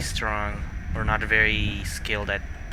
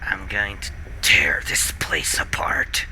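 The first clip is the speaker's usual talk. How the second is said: whispering; 3 semitones lower, slower than usual. Real, not acted. Frustrated gamer threatening the game.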